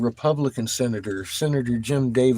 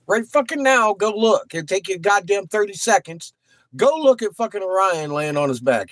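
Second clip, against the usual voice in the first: high, insistent, punched-out order; urgent command; 9 semitones higher than usual. Real, not acted. Streamer sending viewers out to see Orion, urgent command. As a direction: push them to act this instant. Urging viewers outside immediately.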